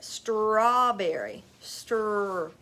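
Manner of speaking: Strawberry and Stir drawn out very slowly; sounding out. Shown as a demonstration. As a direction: stretch the opening blend of Strawberry, then isolate Stir. Teacher sounds out missed blend.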